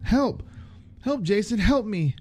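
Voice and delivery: high desperate cries; calling for help. A narrator's reading. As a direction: scream for Jason, pitched high. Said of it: "she called for help".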